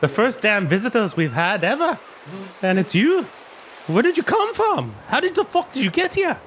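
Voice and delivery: put-on high gruff-sounding old dwarf woman. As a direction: speak up high as the weathered dwarf. GM voices the dwarf.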